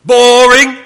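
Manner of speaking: loud, high, drawn-out "boring"; mock bored outcry. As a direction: call it out long and high, mocking dull lessons. Derisive callout about reading the quarterly.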